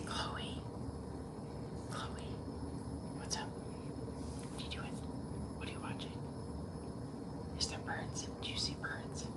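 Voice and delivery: soft whisper to a pet. Real, not acted. Owner asking Chloe about the birds, whispering. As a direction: whisper little questions, barely audible.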